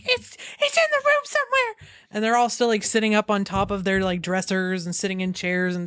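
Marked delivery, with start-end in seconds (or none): 0.0-1.9 very high, squealed mock fright; hammed-up high-pitched alarm; panicking. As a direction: play a panicked girl shrieking. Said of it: "just all panicking".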